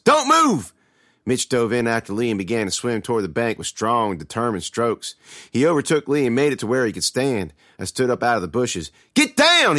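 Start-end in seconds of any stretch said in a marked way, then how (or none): 0.0-0.7 high, loud warning; yell. A narrator's reading. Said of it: "he yelled when he surfaced". 9.1-9.8 high, loud urgent warning; yell. A narrator's reading. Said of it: "he yelled".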